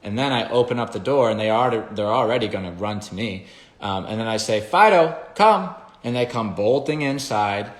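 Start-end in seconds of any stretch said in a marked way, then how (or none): 4.6-5.6 loud, high call of the dog's name. Shown as a demonstration. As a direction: call Fido in across the yard, much higher and louder. Calling the dog inside.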